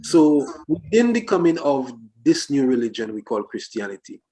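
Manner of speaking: ordinary talk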